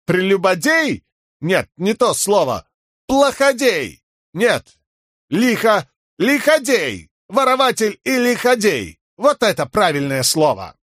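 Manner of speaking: hammed-up Horneater accent. A narrator's reading. Fake Horneater hunts for word.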